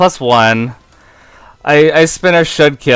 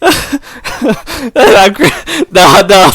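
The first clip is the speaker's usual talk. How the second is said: normal talking voice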